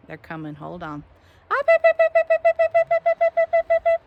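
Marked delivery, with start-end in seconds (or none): 1.5-4.0 high, loud, rapid-fire beeps; chicken call. Real, not acted. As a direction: call the hens with shrill staccato beeps. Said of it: "That's how I say, "Come here, chickens".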